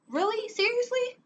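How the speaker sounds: high, incredulous squeak; high incredulous disbelief. Retold or imitated. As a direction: sound totally shocked. Reacting to his cheating.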